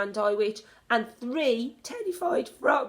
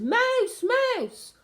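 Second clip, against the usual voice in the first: high, loud cry of mouse; alarmed shout; 7 dB louder than usual. A narrator's reading. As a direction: shriek the alarm. Imagined shout.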